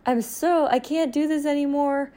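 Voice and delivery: whiny mom complaint. Retold or imitated. Mocking moms on Instagram in a high whine. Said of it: "these moms on Instagram being like".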